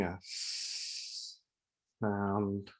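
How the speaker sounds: long gentle hiss on "s-" before finishing "sound"; drawn-out S. Shown as a demonstration. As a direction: stretch out the "s-" softly, then land on "sound". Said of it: "make a soft, gentle s-, sound".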